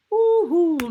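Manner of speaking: very high, loud woohoo; sudden high-pitched cheer; loud whoop. Real, not acted. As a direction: let out a piercing held whoop. Host whoops while squeezing stress ball.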